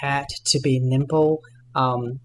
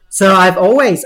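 laughing; 6 semitones higher, 11 dB louder than usual. Real, not acted. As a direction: still laughing, high and loud. Laughing after joke.

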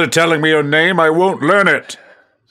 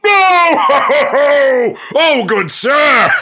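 big theatrical laugh, slow and loud; hammy fake laugh; 13 semitones higher, 4 dB louder, much slower than usual. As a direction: laugh it up like a stage actor.

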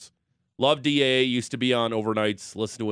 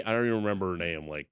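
usual speaking voice

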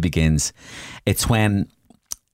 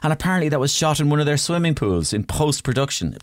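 usual speaking voice

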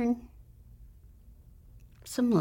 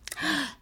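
a quick startled intake of breath, pitched high; sharp shocked gasp; 7 semitones higher than usual. Retold or imitated. Husband hearing about his dead father, sharp gasp. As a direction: gasp as if the words just hit you.

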